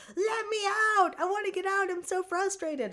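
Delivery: high squeaky trapped-cat voice; voicing the cat. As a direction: whine as the frustrated cat wanting out. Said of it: "He's just like".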